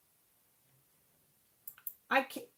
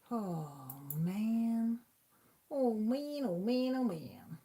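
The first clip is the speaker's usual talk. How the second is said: exasperated; much slower than usual. Real, not acted. Exasperated by chat not working.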